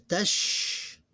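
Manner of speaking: tash as a single loud syllable; one syllable. Shown as a demonstration. Teacher showing students one syllable, drawn-out and clear. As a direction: say "tash" in one beat, holding the final sh. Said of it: "one syllable".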